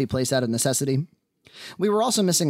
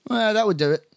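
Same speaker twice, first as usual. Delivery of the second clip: higher-pitched Irish bar owner; Irish accent; 8 semitones higher than usual. Acted. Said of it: "she barked in an Irish accent".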